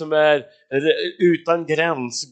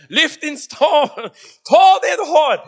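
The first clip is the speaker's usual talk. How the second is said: high, loud, emphatic order; God's command; 10 semitones higher, 9 dB louder, slower than usual. Acted. God telling Moses to use his staff, shouted command. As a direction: shout the order with full force.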